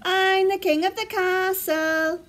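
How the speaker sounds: put-on high, sing-song child's boast; shouting. A narrator's reading. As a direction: crow it like a child at play. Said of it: "he shouted".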